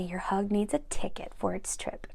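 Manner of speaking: breathy whispered storytelling, no voice; soft whisper reading. Acted. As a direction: whisper it close, like a secret bedtime story.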